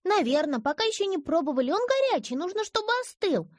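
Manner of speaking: put-on high eager little girl voice; child voice. A narrator's reading. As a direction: explain like a busy small child. Girl on the phone. (детский голос)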